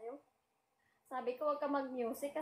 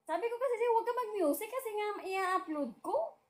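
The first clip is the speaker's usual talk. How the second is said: higher, louder, insistent; exasperated repeat; 7 semitones higher, 4 dB louder than usual. Real, not acted. Repeating herself to someone not listening. (naiinis na pag-uulit)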